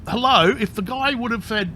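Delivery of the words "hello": re-enacted call for attention, higher. Patient in the scanner to staff, retold calling out. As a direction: call out to someone out of sight, retold. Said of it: "when I first called out".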